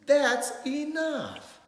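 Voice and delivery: high, slow, shouted reprimand; whole town's fed-up yell; loud scolding. A narrator's reading. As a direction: stretch it out as a final warning. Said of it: "the whole town scolded".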